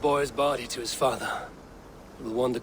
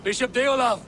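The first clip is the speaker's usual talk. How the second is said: calling out; 9 semitones higher than usual. Acted. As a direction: call him from afar. Calling out to the bishop.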